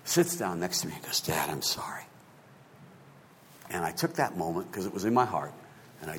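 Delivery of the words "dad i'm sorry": near-whispered, low apology from a son; hushed apology. Retold or imitated. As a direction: barely voice it, humbly sorry. Humbled son's apology retold.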